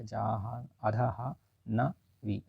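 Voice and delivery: जाहा- अधः न वी, slow, soft and syllable by syllable; slow spelling out. Shown as a demonstration. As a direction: break जाह्नवी into its parts, very slowly. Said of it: "जाह्नवी ऐसे लिखा जाएगा".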